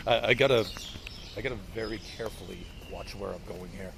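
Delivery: usual speaking voice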